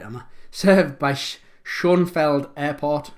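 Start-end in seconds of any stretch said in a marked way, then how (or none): 1.6-3.1 "Schoenfeld" read as it is spelt, loud and slow; anglicised mispronunciation. Real, not acted. Quizmaster unsure of a foreign airport name, "Schoenfeld" anglicised. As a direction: say "Schoenfeld" slowly in English sounds, without the accent. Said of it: "I'm not gonna pronounce this right".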